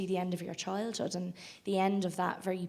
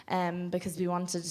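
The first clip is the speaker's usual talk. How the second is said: normal talking voice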